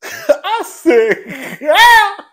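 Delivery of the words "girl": disbelief. Real, not acted. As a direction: squeal it in disbelief. Reacting in disbelief.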